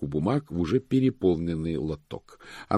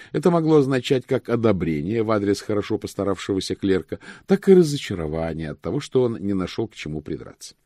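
ordinary delivery, nothing marked

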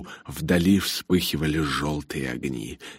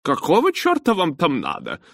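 much higher, exasperated driver's question; gruff disbelief; 12 semitones higher, 3 dB louder than usual. A narrator's reading. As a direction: demand it in cranky astonishment. Foul-mouthed driver demands to know.

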